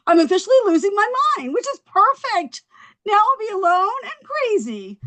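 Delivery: high-pitched, worked-up rant; frantic unraveling. Acted. Jilted wife losing it on stage, frantic rant. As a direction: spiral upward as if coming undone.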